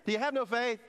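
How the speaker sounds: high, loud mock rebuke; mock spiritual rebuke. Parent mock-rebukes kids who run in at night. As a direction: sound real spiritual. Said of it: "Try that one with your kids".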